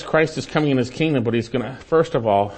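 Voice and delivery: ordinary talk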